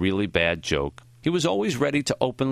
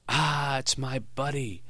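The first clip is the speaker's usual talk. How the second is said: put-on higher, slow old man's greeting; foggy, high-pitched voice; 5 semitones higher, 4 dB quieter, slower than usual. A narrator's reading. Greeting a visitor who just came in, foggy and high-pitched. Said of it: "foggy, high-pitched voice".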